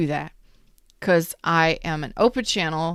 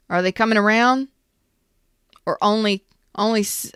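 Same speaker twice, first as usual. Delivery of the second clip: ordinary delivery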